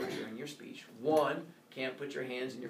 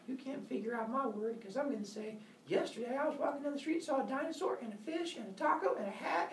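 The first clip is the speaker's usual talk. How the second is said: imitated kid; 10 semitones higher than usual. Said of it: "I have kids who are like".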